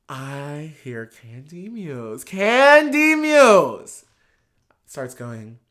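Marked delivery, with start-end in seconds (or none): 2.2-4.0 stretched out, high and loud like a fan shouting a name; hype announcement. Real, not acted. Telling the birthday story, hyping the queen's name. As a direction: cheer the queen's name slowly.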